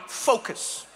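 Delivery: sharp, repeated one-word command; mock serious. Dutch cyclist riding straight-backed, mock-serious intensity. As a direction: bite out each word with grim purpose. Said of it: "in such a serious way".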